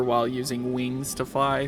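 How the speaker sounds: normal talking voice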